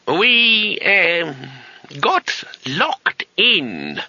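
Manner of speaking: high, slow and halting admission; reluctant old wizard. Acted. Old wizard confessing they are trapped, reluctant and slow. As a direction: admit it grudgingly, dragging each word.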